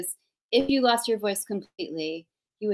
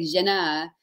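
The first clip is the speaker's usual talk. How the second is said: imitated fierce Frenchwoman with French accent; put-on French accent. French agent objecting to being mimicked, French accent. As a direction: lay on a protesting French accent. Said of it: "When I do her accent".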